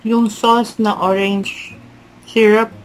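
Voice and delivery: speaking between bites; talking while chewing. Real, not acted. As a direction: mouth partly full of food. Seen 0: Bite at -4.0; lips keep chewing-like motions while talking, holding slice.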